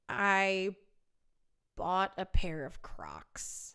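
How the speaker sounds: slow, near-whispered admission; hushed confession. Real, not acted. Singer confessing to viewers about shoes, hushed confession. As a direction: confess it slowly under your breath. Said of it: "I have to make a confession".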